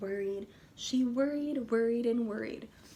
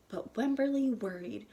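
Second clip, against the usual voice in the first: ordinary delivery, nothing marked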